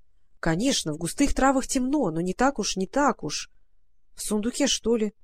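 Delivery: ordinary delivery, nothing marked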